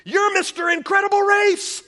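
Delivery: put-on high, loud little-boy blurt; kid blurting out. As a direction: blurt it out like an excited small boy. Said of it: "he blurts out".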